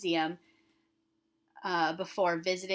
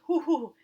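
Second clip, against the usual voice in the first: playful hooting; 7 semitones higher, 6 dB louder, faster than usual. Real, not acted. Teacher exclaims at question.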